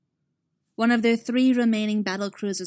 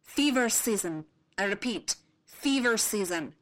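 insisting; 2 semitones higher than usual. Acted. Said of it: "Irte insisted".

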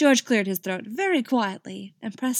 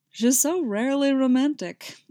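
higher, grinning tease; smile in voice; 6 semitones higher, 5 dB louder than usual. Acted. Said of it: "hear the smile in his voice".

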